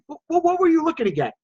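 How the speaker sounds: helpful seller. Acted. Seller role-play, calm and helpful.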